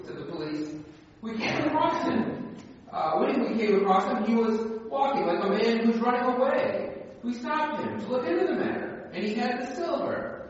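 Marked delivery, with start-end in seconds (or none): 1.2-10.5 lightly voiced policeman, pitched higher. Pastor voicing the police explaining to the bishop, higher. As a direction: report the arrest to the bishop.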